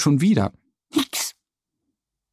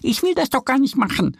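higher little duck, lightly whining; a young duck's mild complaint, voiced higher; whiny duck voice; 11 semitones higher, faster than usual. A narrator's reading. Duck Annie whining to Mo, higher mild whine. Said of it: "jammert Annie".

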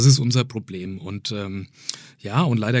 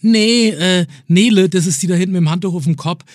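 higher voice, playing a small kid; lightly voiced little kid; little boy voice; 6 semitones higher, 4 dB louder than usual. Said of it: "Und dann sagt so ein kleiner Bengel".

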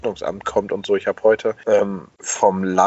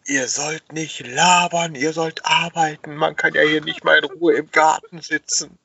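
retold yell; 7 semitones higher than usual. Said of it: "schrie jemand".

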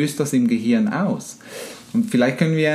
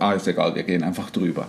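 lower, softer, offhand self-talk; careless autopilot thought; 6 semitones lower, 4 dB quieter, faster than usual. Acted. The subconscious on autopilot walking over the lawn, careless and low. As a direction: shrug it off under your voice, acting the thought. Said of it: "in diesen Autopilot-Situation einfach über den Rand und denkt".

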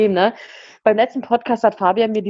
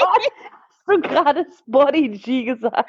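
laughing; 6 semitones higher, slower than usual. Real, not acted. Laughing at slip.

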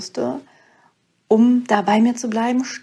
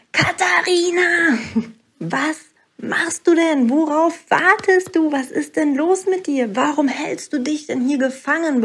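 the inner voice raging at her to go; inner voice yelling; 5 semitones higher than usual. Retold or imitated. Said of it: "fing diese Stimme an zu schreien". (höher, drängend rufende innere Stimme)

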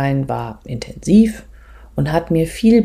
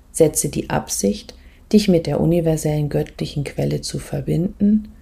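soft, calm meditation voice, often whispered; guided meditation; 4 dB quieter than usual. Acted. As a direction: guide the listener gently inward.

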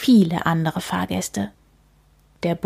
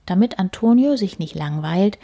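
ordinary delivery

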